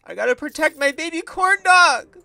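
put-on rally to defend the baby; protective shout. Gamer vowing to protect her baby corn dog, loud heroic shout.

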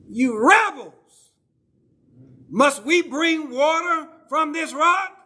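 loud, high, slow furious cry; angry shout. Retold or imitated. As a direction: Moses shouting at the multitude in rage. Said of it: "And he, in anger".

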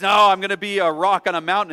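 put-on the runaway child daring his mother; defiant bunny. Bunny voice in story.

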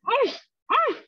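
high tearful whimper; trapped lizard whimpers in despair. Acted. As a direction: whimper helplessly.

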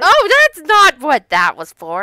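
high, loud exclamation of dismay; loud protest. Real, not acted. Correcting friends about the item, loud protest. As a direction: object loudly, pitched well up.

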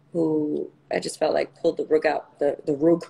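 ordinary talk

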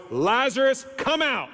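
high, commanding call; loud voice. Acted. Jesus calling Lazarus out of the grave, in a loud voice. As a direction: call out as if summoning someone from a tomb. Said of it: "he says in a loud voice".

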